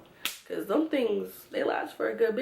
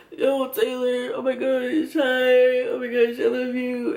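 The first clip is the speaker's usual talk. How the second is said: slow, slurry drunk gushing, with a lightly voiced happy drunk; a drunk who is overjoyed to see her; drunk boyfriend; 2 semitones higher, slower than usual. Drunk Tim greeting his girlfriend, lightly voiced gushing slur.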